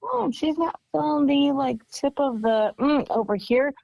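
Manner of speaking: playful mimicry. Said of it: "can look at you and say".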